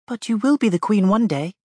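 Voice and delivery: put-on high, curious child; a small girl asking innocently; little girl voice. Little girl answers the Princess.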